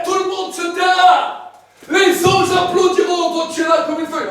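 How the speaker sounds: loud, high booming proclamation; preaching at full force. Acted. Preacher describing heaven's throne to the congregation, loud. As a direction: shout the glory of heaven to the crowd.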